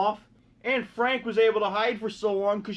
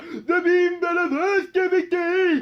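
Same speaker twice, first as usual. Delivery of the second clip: slow, higher, strange put-on voice; odd robotic Symmetra; 5 semitones higher, 4 dB louder, slower than usual. Symmetra answering her friends, slow and strange. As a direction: drag it out like a stiff robot. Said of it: "And she's like".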